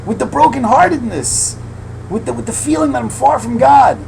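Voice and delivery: dance with the words; fervent preaching. Real, not acted. Preacher describing his dance, rapt and high.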